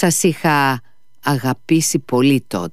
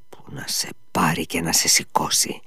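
low, softer, grim muttering; muttered curse; 5 semitones lower, 5 dB quieter than usual. A narrator's reading. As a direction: curse it under the breath. Ivan curses again, subdued. (σιγανή, βαριά κατάρα)

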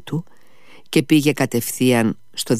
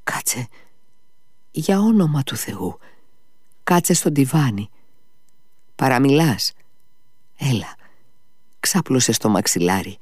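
frightened; 3 semitones higher than usual. A narrator's reading. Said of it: "πρόφερε φοβισμένος ο Αλιώσα".

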